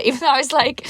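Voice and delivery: ordinary talk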